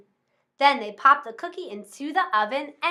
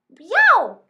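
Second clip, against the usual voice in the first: loud high dog yelp; 6 semitones higher, 10 dB louder than usual. Acted. Mangy dog yelping when the can is kicked. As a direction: yelp as the can hits your head. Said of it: "yelled the dog".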